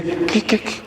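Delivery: put-on breathy half-asleep whisper of an old man; sleepy whisper. Old pastor Elí woken at night, whispering sleepily. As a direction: whisper it like someone just woken. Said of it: "Y le dice".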